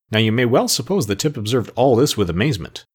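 ordinary delivery, nothing marked

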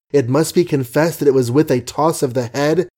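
plain reading voice

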